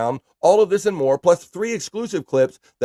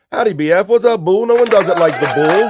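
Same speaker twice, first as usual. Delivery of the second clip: louder, higher, big booming welcome; hyped-up greeting voice; 7 semitones higher, 7 dB louder than usual. Acted. Host reading a fan's greeting aloud, loud and hyped. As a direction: boom it out like a hype man.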